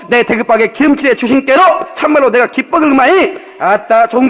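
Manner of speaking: loud, rousing Jeolla-dialect recitation. Acted. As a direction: preach the psalm in thick Jeolla dialect at full volume. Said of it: "전라도 버전 다시 한번 읽어주려고 그래".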